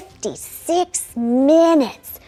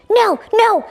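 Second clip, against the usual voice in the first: eager pleading; 9 semitones higher than usual. Acted. Begging instructor for more flying.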